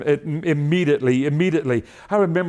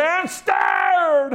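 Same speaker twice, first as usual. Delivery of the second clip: high, imitated holler; mock full-out holler, pitched up; Brother Buck Moore; 11 semitones higher than usual. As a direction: holler it high, mimicking an eager preacher. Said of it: "he'd holler loud as he could".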